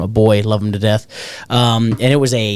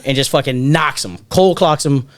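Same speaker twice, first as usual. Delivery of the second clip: ordinary delivery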